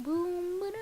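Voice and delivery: ordinary delivery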